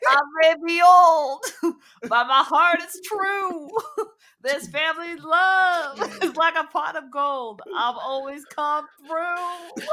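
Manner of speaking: put-on high, laughing old granny. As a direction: rap it like a giggling grandma. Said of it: "do it in a granny voice".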